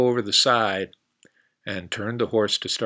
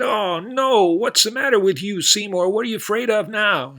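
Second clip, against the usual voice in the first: much higher, louder cowboy cry; cowboy fed up with his horse, high and loud; exasperated cowboy; 12 semitones higher, 8 dB louder than usual. Acted. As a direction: throw up your hands like a cowboy.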